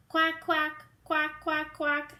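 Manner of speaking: high, loud duck shouting quack. Acted. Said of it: "shouts Dottie".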